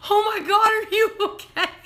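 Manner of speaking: can't stop laughing while asking if he's hurt; laughing alarm. Real, not acted.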